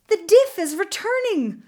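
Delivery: high, loud cry of warning; alarmed cry. A narrator's reading. Young woman warns her rescuer the diff is coming, crying in alarm. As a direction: cry out the danger, pitch leaping up. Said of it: "Beautiful of the Earth cried".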